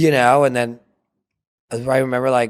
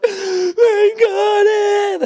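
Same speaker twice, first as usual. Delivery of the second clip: very high, drawn-out tearful squeal; re-enacted happy bawling; bawling with joy; 5 dB louder, much slower than usual. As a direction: wail the happy news, loud and long. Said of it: "I was bawling".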